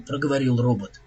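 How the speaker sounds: ordinary delivery, nothing marked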